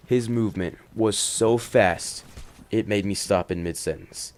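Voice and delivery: ordinary delivery, nothing marked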